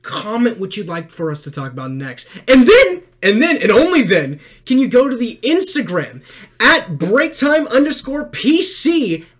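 loud, high promo hype; hype outro. Acted. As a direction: pump up the audience. Hyped call to subscribe.

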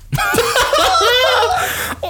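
sudden squealing laugh; high burst of laughter. Real, not acted. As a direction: break up laughing. Bursts out laughing after reading.

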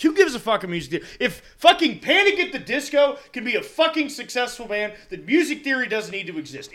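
heated rant against a band. Real, not acted. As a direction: rant it out, worked up. Ranting about bad bands.

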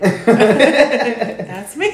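loud, high, laughing "That's me"; That's So Raven catchphrase. Retold or imitated. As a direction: belt it like a sitcom catchphrase. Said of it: "Like Raven".

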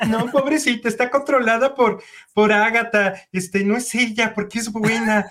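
mock a fan defending his crush; imitating Diego. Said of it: "Diego se la pasa defendiendo a su novia Wanda".

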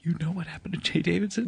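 whisper. Pretend. Secretive question to an orphan.

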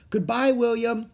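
high-pitched goodbye; farewell call. A narrator's reading. Annie calls farewell to departing friends.

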